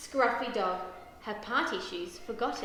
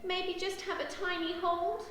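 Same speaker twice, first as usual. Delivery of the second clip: hopeful pleading; 7 semitones higher than usual. A narrator's reading. Daisy asks Mum for a tiny hold, pleading. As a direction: ask sweetly, pitched up high. Child begs to hold the puppy.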